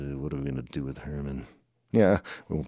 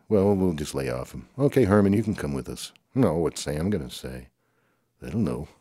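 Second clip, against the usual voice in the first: normal talking voice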